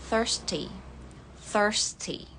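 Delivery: thirsty as "thərsti", R sounded, American; American thirsty with the R rolled in; American accent. Shown as a demonstration. Teacher showing American thirsty with the R. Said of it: "Kalau American".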